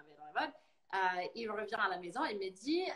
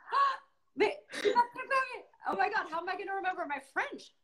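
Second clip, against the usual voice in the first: retold panic; 9 semitones higher than usual. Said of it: "Et j'ai dit".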